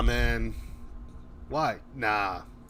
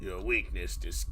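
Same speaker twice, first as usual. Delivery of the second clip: Shadow quote; 6 semitones lower, 7 dB quieter than usual. Retold or imitated. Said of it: "when Shadow just says".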